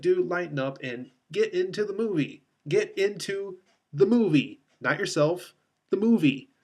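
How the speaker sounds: loud, high-pitched, emphatic urging; mock-exasperated telling-off; exasperated plea. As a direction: plead with an actor, punching the key words. Acted exasperated plea to the actor.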